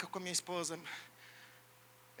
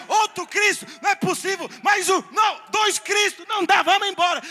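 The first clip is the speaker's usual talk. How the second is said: frantic yelling, voice pitched way up; panicked shout; 8 semitones higher, 12 dB louder than usual. Acted. Demon panicking at seeing Christ, shouting. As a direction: yell it like a demon fleeing in panic.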